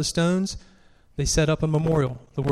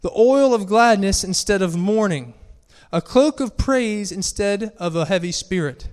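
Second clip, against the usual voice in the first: raised, proclaiming scripture voice; scripture reading; 4 semitones higher, 3 dB louder than usual. Acted. Preacher reading Isaiah to the congregation, proclaimed scripture reading. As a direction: read it out high and declaratory, phrase by phrase.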